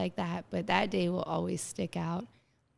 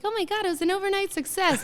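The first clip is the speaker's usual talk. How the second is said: gushing fan; 12 semitones higher, 6 dB louder, faster than usual. Retold or imitated. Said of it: "everybody's like".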